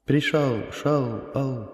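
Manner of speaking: echo. Acted. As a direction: let the words echo away into a cave. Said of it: "эхо переместилось из пещеры".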